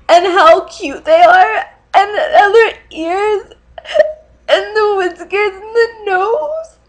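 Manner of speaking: high, loud, sobbing wail; crying. Real, not acted. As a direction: blubber it out, overwhelmed with love. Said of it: "promised myself I wouldn't cry".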